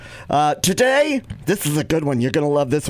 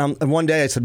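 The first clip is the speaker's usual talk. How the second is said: usual speaking voice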